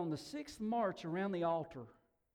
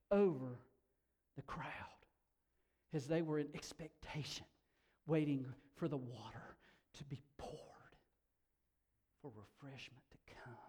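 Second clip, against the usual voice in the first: hushed expectation; 5 semitones lower, 5 dB quieter than usual. Real, not acted. Preacher describing a crowd waiting for water, growing hushed. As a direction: lower and softer, fading towards a whisper.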